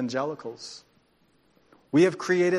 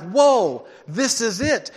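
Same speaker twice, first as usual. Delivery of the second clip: excited whoa; 6 semitones higher, 11 dB louder, much slower than usual. Retold or imitated. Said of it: "I'm like".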